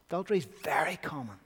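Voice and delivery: hushed whisper, confiding. Real, not acted. Preacher whispering to the congregation about adultery. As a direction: lean in and whisper it.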